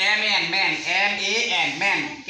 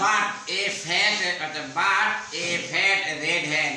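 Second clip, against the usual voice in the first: slow reading; much slower than usual. Shown as a demonstration. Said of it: "teacher models the sentence slowly".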